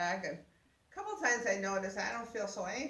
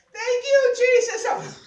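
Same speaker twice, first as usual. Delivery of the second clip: high, loud thanks to Jesus; retold joyful yell of thanks; shouted praise; 14 dB louder, slower than usual. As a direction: shout it out, laughing with joy. Praise in elevator.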